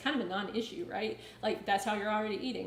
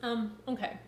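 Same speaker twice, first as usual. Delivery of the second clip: ice in mouth. Real, not acted. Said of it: "Sorry, got some ice in my mouth".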